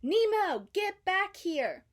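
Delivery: loud, high call, slow on get back here; shout. A narrator's reading. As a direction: yell after him across the water. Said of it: "Marlin shouted".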